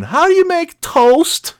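high, slow, clueless whimper; helpless whine. Pretend. Lazy husband asks wife for help, high helpless whine. Mocking his own helplessness.

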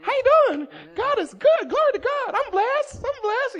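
mock the too-blessed saints; overly cheery church folks. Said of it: "I'm like, you know".

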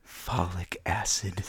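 put-on slow whispered creepy old man. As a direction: breathe it out slowly, creepy and old. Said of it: "creepy old man voice".

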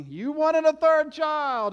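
high, loud, slow mock scream; imagined scream. Wife on the delivery table blaming him, mock scream. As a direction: hurl the blame like a wife in labor. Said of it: "screaming at me".

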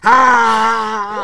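half-laughing yell; a high fake scream; mock shout. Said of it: "Unless you're shouting".

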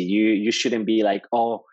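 normal talking voice

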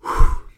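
loud breathy exhale; big sigh to get serious; resetting sigh. Real, not acted. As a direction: blow out a breath to compose yourself.

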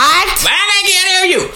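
very high yell over a blender; high-pitched, hammed-up holler; shouting over noise. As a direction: yell as if you can't hear. [name] yelling over the blender.

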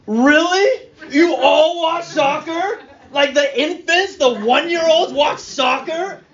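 high, slow, hammed-up disbelief; mock incredulous. Preacher acts out a joke reply to the Iranian mechanic, mock-incredulous. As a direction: pretend to be amazed, stretching each word.

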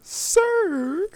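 high, loud, drawn-out mock yell; mock outburst. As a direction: stretch it into an over-the-top high cry. Mock echo of the storyteller's outburst.

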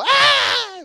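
high, loud mock baby scream; imitated baby shriek. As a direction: shriek it like a newborn in pain. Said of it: "el otro". (chillido de bebé imitado, agudo y fuerte)

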